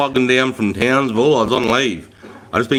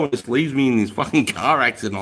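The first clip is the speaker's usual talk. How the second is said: ordinary delivery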